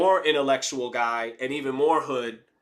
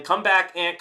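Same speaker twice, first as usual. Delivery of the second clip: calling out loud; 5 semitones higher, 6 dB louder than usual. Real, not acted. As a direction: call out, louder and higher. Calling viewers to return.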